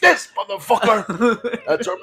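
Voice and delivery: high-pitched, incredulous outrage through laughter; amused outrage. Retold or imitated. Telling co-host about her turn-off reply, amused outrage. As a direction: drag out the insult high, half laughing.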